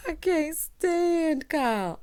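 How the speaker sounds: slow, high, stretched out stand; I can't stand Kyle with stand stretched slow and high; drawn-out disgust. Real, not acted. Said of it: "I really cannot".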